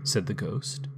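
ordinary delivery

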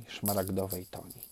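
usual speaking voice

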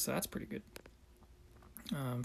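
usual speaking voice

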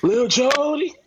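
high, drawn-out teasing call; high playful movie-quote voice; high-pitched mock call. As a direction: call out the name in a high voice, acting a movie scene. Mock-calling a movie character.

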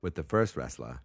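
put-on Boston accent, dropped r's. Shown as a demonstration. Host explains how to win, Boston accent. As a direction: read the rules like a Bostonian. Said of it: "talk with a real Boston accent".

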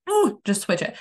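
high, impatient little outburst; retold irritation. Irritated at husband's different mindset, impatient. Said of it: "I get irritated".

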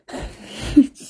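short laugh to oneself; amused burst of laughter. Real, not acted. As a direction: crack up at your own joke. Cracks up at own crude detail.

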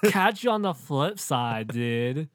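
loud slow surfer goodbye; surfer dude voice. Pretend. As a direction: sign off like a surfer. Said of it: "surfer voice".

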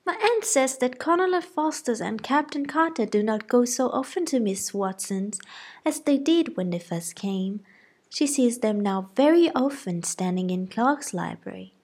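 put-on high, eager young gossip; chattering excitedly to mamma; gossiping girl. A narrator's reading. Lydia voiced.

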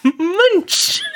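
high, silly squeal; Munch voice. Pretend. Echoing Motor City Muncher in the Munch voice. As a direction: blurt the name in a squeaky voice.

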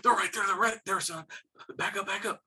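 high-pitched, urgent warning; nervous. Retold or imitated. Nervous dad spotting pigs on the walk, imitated urgency. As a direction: imitate a jumpy hunter alerting a companion. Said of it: "nervous, stops".

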